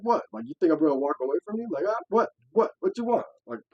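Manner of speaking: punchy mock-tough challenge; retold confrontation. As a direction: throw it out like picking a fight. Retold squaring up before a scuffle.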